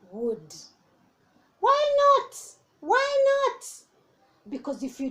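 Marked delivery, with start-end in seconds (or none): 1.6-2.4 put-on squeaky little child asking; wide-eyed child begging to know; high child voice. Child asks father. 2.8-3.6 put-on squeaky little child asking; wide-eyed child begging to know; high child voice. Child asks father.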